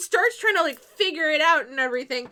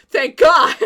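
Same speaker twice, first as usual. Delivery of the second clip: much louder relieved sigh of words through a laugh; laughing relief; 11 dB louder than usual. Retold or imitated. Boyfriend relieved his girlfriend has taste, laughing relief. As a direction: exhale it like a weight is off.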